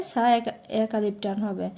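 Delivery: normal talking voice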